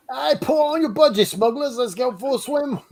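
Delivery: high, hammed-up Australian call to a mate; a cheeky Australian accent urging a friend to swim; mock Australian accent. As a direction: sing out the invite in a put-on Aussie accent.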